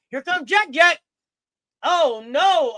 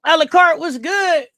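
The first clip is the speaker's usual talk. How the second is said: loud greeting call; calling out to a viewer; hype shout; 3 semitones higher, 8 dB louder than usual. Real, not acted.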